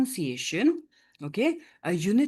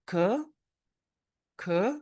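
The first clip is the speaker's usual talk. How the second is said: "courageous" with long gaps between syllables; faster than usual. Shown as a demonstration. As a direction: isolate each syllable of "courageous", starting from "co-". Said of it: "Rather, we pronounce this as".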